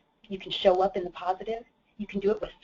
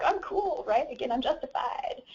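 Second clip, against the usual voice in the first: much higher, mock smug; smug self-assurance; 7 semitones higher than usual. Voicing her inner excuse, mock smug higher voice. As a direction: sell the lie to yourself. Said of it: "you're like".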